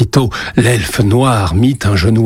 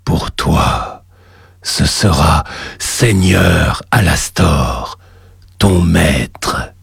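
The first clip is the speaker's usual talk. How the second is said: deep, slow demon lord voice; low and slow, a voice from the depths; cavernous voice; 4 semitones lower, much slower than usual. Acted. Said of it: "une voix caverneuse".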